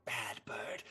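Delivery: whispered scolding witch. Acted. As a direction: hiss it at the bird. Witch punishes crow.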